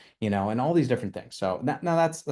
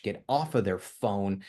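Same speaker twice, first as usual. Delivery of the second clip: usual speaking voice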